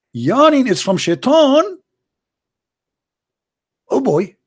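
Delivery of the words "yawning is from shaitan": incredulous repeat. Real, not acted. Mock disbelief at the hadith.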